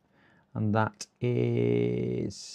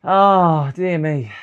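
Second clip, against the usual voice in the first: loud dismay; 6 semitones higher, 10 dB louder, much slower than usual. Real, not acted.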